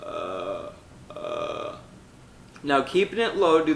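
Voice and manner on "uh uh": low, soft creaky fry; vocal fry. Shown as a demonstration. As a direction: drop the larynx and fry. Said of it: "produce vocal fry".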